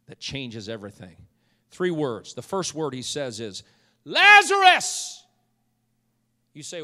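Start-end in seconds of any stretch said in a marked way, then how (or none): 4.0-5.2 loud, high, drawn-out shout; commanding call. Acted. Jesus calling Lazarus out of the tomb, commanding shout. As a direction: call the name out long and powerfully. Jesus calls the dead man out.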